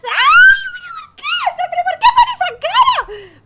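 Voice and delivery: very high, loud laughing shriek. Real, not acted. Shrieking at the scene. (chillido agudísimo entre risas)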